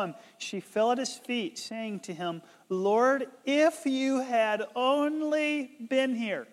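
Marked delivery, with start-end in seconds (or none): 2.7-6.5 higher, slow, drawn-out reproach; lightly acted grieving plea, stretched out; pleading lament. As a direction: plead slowly as a grieving sister. Acting Mary falling at Jesus' feet.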